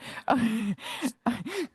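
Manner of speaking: short amused laugh. Real, not acted. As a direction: laugh at the garbled audio. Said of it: "we're only laughing because".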